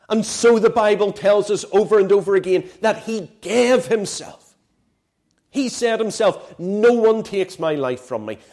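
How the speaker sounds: loud, rising sermon voice, hammering each point; full-force preaching. Acted. Preacher driving home to his friends, loud full-force preaching.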